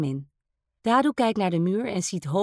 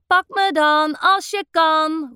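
teasing call, louder and drawn out; playful call; 7 semitones higher, 5 dB louder, slower than usual. A narrator's reading. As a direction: dare him to catch you, slowly. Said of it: "roept Hokey Spokey".

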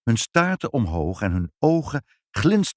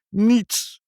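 tearful; 13 semitones higher, 4 dB louder than usual. A narrator's reading. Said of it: "de tranen druppelden in zijn thee".

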